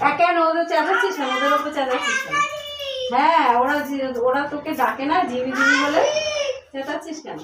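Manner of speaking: scolding the dog for yelling at them, high and loud. Real, not acted.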